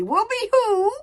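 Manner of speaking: high echoing whoop, a touch urgent. Acted. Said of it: "said One Eye urgently".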